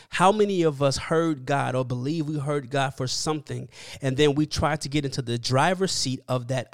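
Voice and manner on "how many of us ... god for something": ordinary talk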